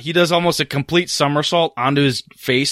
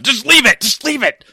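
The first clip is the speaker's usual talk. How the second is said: impatient boss; 10 semitones higher, 3 dB louder than usual. Acted. Boss dismissing muscle hastily.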